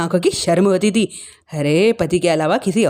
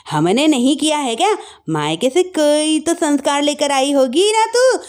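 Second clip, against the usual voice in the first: berating; 7 semitones higher than usual. Acted. Mother-in-law berates the daughter-in-law.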